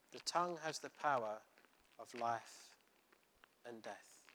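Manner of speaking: tongue stressed, fading to a hush on death; slow down and let death drop quiet; slow emphatic repeat. Shown as a demonstration.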